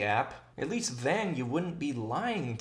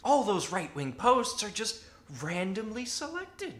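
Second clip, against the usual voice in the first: high, put-on critic voice; mock objector; 9 semitones higher than usual. Imagined critic excusing the app's featured posts, high mock objection. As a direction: argue it high, as a hammed-up defender.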